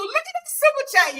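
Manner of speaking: high, loud thrilled cry; excited shout. Real, not acted. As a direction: show off the big donation. Big donation arrives.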